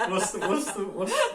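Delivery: high-pitched giggling stammer; can't finish for laughing. Real, not acted. Giggling at photo.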